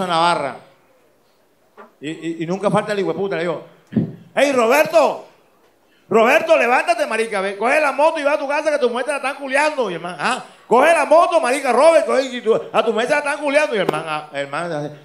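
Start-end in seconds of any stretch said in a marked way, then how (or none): 4.3-9.9 high, loud alarmed yelling; urgent calling out. Acted. Troublemaker rousing a man at the bar, urgent high shouting. 10.2-13.7 high, loud alarmed yelling; urgent calling out. Acted. Troublemaker rousing a man at the bar, urgent high shouting.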